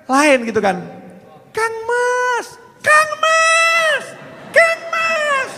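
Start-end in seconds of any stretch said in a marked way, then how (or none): 1.5-5.5 very high, drawn-out lover's call; calling a sweetheart. Shown as a demonstration. Showing how one calls a beloved, high lovestruck call. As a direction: coo it out in a high put-on voice. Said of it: "kalau manggil kekasih kan lain".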